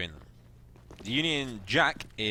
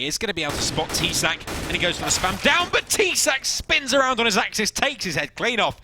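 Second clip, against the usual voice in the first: rising, louder play-by-play as the kill lands; excited commentary; 11 semitones higher, 6 dB louder than usual. Real, not acted. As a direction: call the big play with rising hype. Big play in live commentary.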